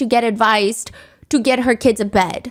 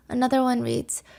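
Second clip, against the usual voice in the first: usual speaking voice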